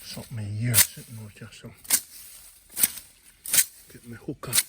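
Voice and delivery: hushed and careful, barely voiced. Real, not acted. Creeping toward a snake in the bush, hushed. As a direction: keep it down so the snake won't hear.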